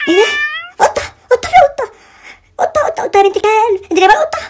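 startled high exclamation; shocked. Real, not acted. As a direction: squeal at the cat on screen. Shocked by cat filter.

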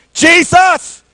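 high, insistent shout. Acted. Blind man calling Jesus over the crowd, shouting. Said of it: "He shouted more".

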